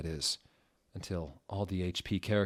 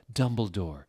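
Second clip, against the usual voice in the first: ordinary delivery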